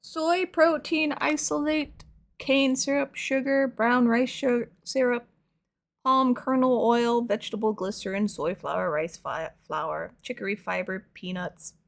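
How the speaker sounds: slow, deliberate reading of the label. Real, not acted. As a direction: read it out word by word.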